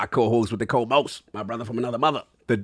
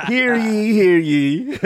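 town crier; 6 semitones higher, 7 dB louder, much slower than usual. Pretend. Crier-style call opening the show.

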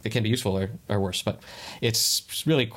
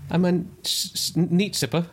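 higher, playful Scottish brogue; mock Scottish accent; 7 semitones higher than usual. Answering about his neat whisky, hammed-up Scottish. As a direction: ham up a Scots drinker.

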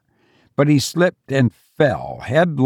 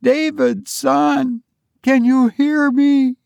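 high, insistent call; 13 semitones higher than usual. A narrator's reading. Mother calling into darkness for son, calling out. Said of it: "she called".

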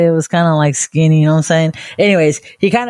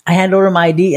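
usual speaking voice

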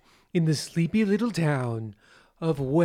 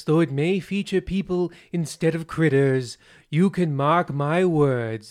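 normal talking voice

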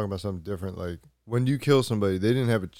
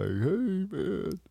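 higher, soft laid-back greeting; imitating a mellow enemy; mock chill player; 8 semitones higher, 7 dB quieter than usual. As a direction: greet like a relaxed stranger. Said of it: "they're just like".

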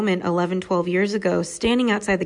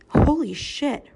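amazed; 8 semitones higher, slower than usual. Real, not acted. Reacting to the girl's wisdom.

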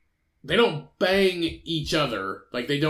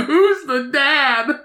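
high, slow, crying-voiced mock question; mock wailing question; 11 semitones higher, 6 dB louder, much slower than usual. Photographer asking who the dad is, in a high fake wail.

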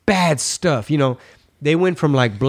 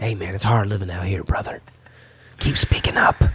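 hushed, furtive whisper, quoting closeted friends; mock covert whisper; 6 semitones lower than usual. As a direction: imitate friends whispering secret support. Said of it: "They like".

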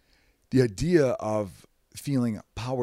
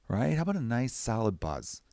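ordinary talk

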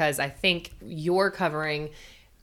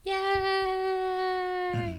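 excited cheer; 13 semitones higher, 7 dB quieter than usual. Pretend. Mock cheer.

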